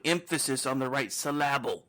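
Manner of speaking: "emphasis" and "syllable" stressed on the second syllable; misplaced stress. Shown as a demonstration. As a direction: say em-PHAS-is and syl-LAB-le for "emphasis" and "syllable". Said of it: "they were mispronouncing words".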